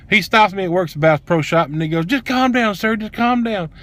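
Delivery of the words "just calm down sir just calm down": high-pitched mock placating; imitated placating. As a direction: imitate someone urgently soothing a customer. Mimics associate trying to stop him.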